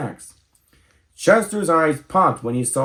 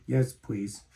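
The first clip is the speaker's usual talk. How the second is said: soft, slightly lower reply; small quiet voice; 3 semitones lower, 7 dB quieter than usual. A narrator's reading. Homesick Chester answers Missus Opossum, quiet voice. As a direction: answer softly and meekly.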